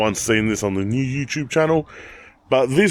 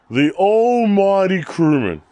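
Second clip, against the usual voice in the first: mock grand; 6 semitones higher, slower than usual. Real, not acted. Proudly hailing his car.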